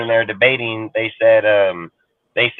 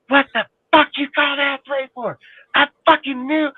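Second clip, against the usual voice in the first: high-pitched yell at the TV, held back in volume; mock yelling. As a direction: imitate yelling at the screen, voice pitched way up. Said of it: "I'd be yelling at the screen".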